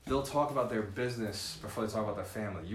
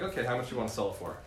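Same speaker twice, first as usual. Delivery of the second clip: mock brisk D; faster than usual. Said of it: "They're like".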